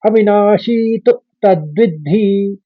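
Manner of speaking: अविनाशी तु तद्विद्धि said slowly, तु short and light, तद् then विद्धि with doubled द्ध; slow syllable recitation. Shown as a demonstration. Teacher demonstrates the first quarter, slow and syllabic. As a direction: chant it slowly, stress falling on तु. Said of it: "पहले चरण को इस तरह से उच्चारित करेंगे".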